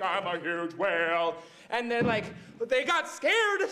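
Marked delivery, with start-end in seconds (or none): 0.0-1.4 low, dopey whale voice. Retold or imitated. Whale greeting the boat, low and dopey. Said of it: "He's like".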